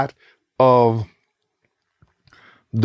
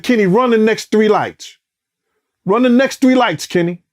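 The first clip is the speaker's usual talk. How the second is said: urgent order; 7 semitones higher than usual. Retold or imitated.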